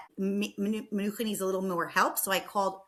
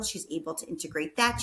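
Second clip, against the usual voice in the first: usual speaking voice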